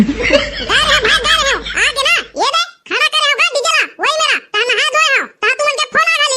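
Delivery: ऊँची, बदली हुई फ़ोन वाली आवाज़ में डीजे वाला; फ़ोन पर बेफ़िक्र डीजे वाला बनकर बोलो; डीजे वाले की आवाज़. Acted. DJ operator on the phone. (दूल्हे के दोस्त से फ़ोन पर बात करता डीजे वाला, ऊँची आवाज़ में)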